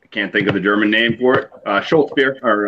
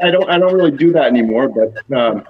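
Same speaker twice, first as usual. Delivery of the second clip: hammed-up voice, higher and louder; mock announcer voice; 8 semitones higher, 3 dB louder than usual. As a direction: play up a silly put-on voice. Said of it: "I will do it".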